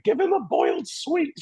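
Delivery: high, slow prim British mother; posh British mum. Retold or imitated. Mum urging to placate the gunman, prim British voice. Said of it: "my mum, who was very British".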